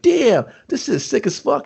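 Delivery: high, slow, drawn-out amazement; excited admiration. Retold or imitated. Friend seeing the new whip, thrilled and high. Acted delight at the new truck.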